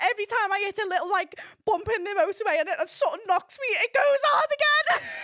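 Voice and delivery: high, loud, breaking into laughter; quoting him through laughter. Retold or imitated. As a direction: squeal his complaint, about to lose it. Retelling his complaint while cracking up.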